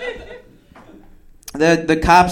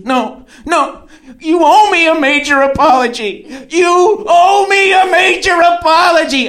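high, forceful demand, building louder; mock angry shout; 11 semitones higher, 3 dB louder than usual. Woman refusing a ride and demanding an apology, mock angry shout. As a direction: imitate a furious date yelling.

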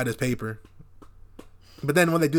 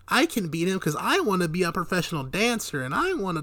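mock Taya; 6 semitones higher than usual. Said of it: "And Taya's like".

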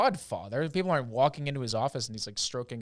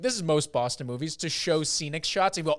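ordinary delivery

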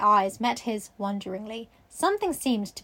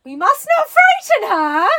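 put-on old gentleman voice; 10 semitones higher, 11 dB louder, slower than usual.

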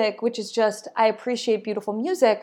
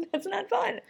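much higher, softer, a little pouty; high soft mock whine; 11 semitones higher, 7 dB quieter than usual. After the laugh, restating the joke in a high soft whine. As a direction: pout it out high and quiet. Repeats line in new voice.

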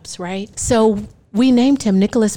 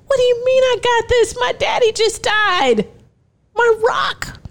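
pitched way up, close to crying; high, tearful panic; 12 semitones higher than usual. Retold or imitated. New mother panics to friends, high and tearful. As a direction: cry out that her rock is gone. Panicked, grieving her father.

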